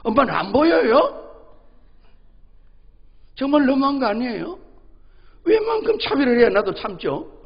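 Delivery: put-on a boy loudly complaining he is treated unfairly; indignant child. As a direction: kid voice up high, hurt and demanding. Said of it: "이러는 거예요".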